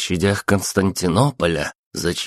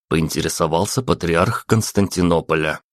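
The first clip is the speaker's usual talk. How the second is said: ordinary delivery, nothing marked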